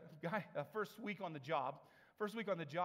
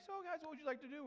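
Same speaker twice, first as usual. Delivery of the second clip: high, bright-sounding employee voice, much higher; cheery employee; 12 semitones higher, faster than usual. Retold or imitated. Employee approaching shoppers, mock cheery high voice. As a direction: play the chipper clerk checking in.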